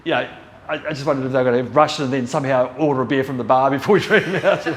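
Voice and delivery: joking, laugh bubbling through the last words; amused, laughing. Real, not acted. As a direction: crack up at your own absurd picture. Joking about gang ordering beer.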